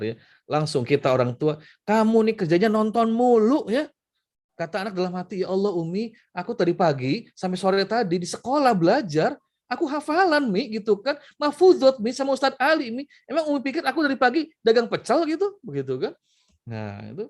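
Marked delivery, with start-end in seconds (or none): 1.9-3.9 higher, sharper, louder scolding; a parent snapping at a child watching TV. Retold or imitated. 5.4-15.6 put-on high, aggrieved child's complaint; high-pitched child grumbling to mum; whiny child. Child's inner protest to mother.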